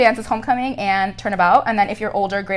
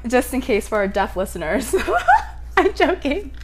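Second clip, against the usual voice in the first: ordinary delivery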